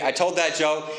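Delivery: ordinary talk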